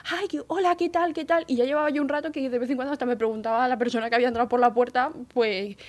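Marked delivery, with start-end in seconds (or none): 0.1-1.4 high-pitched, rushed-over welcome; flustered greeting. Retold or imitated. Boss runs over to greet the visitor, flustered. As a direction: greet him high and gushing, imitating the boss. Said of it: "enseguida va corriendo y dice".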